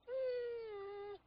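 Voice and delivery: soft, high pretend whimper; small high animal whine; little whimper. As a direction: whimper quietly like a worried pup. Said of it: "he gave him a little whimper".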